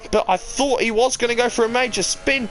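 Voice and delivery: ordinary delivery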